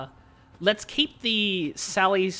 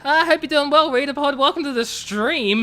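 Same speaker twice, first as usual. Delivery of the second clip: mock-formal hello to a viewer, higher and louder; put-on host voice; 8 semitones higher, 6 dB louder than usual. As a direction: welcome him like a hammed-up announcer.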